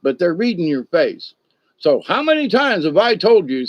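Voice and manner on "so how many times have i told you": stern scolding. Retold or imitated. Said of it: "see, my face is all scrunched up".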